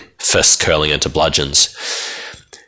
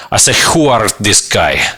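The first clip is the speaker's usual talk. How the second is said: put-on Eastern European accent; 5 semitones higher than usual. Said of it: "the party prince reminds you".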